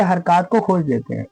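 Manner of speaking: usual speaking voice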